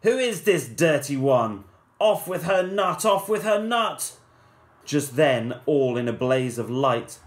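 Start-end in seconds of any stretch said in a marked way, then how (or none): 0.0-4.1 loud, higher furious orders; hammed-up angry royal shout; crying out. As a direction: bark for her execution. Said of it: "The prince cried".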